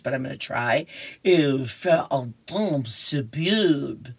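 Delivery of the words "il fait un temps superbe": slow, tentative stab at French; careful, drawn-out amateur French; halting amateur French. Acted. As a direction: attempt French slowly with an English speaker's accent. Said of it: "forgive my French because I don't know how to speak French".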